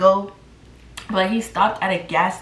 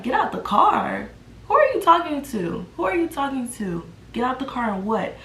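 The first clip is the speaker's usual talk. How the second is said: high-pitched, incredulous comeback; indignant disbelief; 5 semitones higher than usual. Retold or imitated. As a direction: throw it back as an outraged question. Indignant comeback to boyfriend's order.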